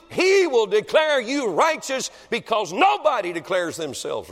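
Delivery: thundering revival preaching; preaching at full force. Acted. Preacher to congregation, full-force revival preaching. Preacher pressing his point.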